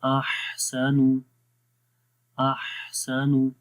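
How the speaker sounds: very slow अहसनु with breathy ह; recitation demo. Shown as a demonstration. Teacher demonstrates breath continuing in अहसनु. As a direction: draw out अहसनु, air flowing on the ह. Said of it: "हा के अंदर भी सांस जारी हुआ".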